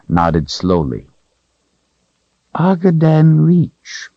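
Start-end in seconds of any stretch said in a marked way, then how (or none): 2.5-4.1 high whining creature voice; creature whine. A narrator's reading. Creature whining to Richard about the Calthrop. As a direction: whine it out, cringing.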